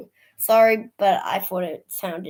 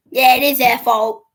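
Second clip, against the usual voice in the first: mock agreement; 12 dB louder than usual. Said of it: "And I'm like".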